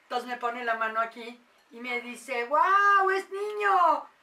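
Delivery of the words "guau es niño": amazed exclamation. Retold or imitated. Instructor's amazed retold exclamation.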